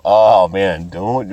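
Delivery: ordinary talk, nothing marked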